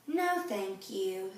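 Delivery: slow, soft little sigh. A narrator's reading. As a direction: sigh it out, gently sad. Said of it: "sighed Camilla".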